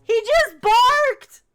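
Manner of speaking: loud, high, slow disbelieving cry; excited shout. Real, not acted. To chat, amazed the game caller barked, excited shout. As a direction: belt out the shock that he barked.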